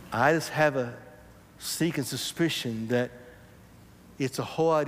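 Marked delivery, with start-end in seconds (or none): none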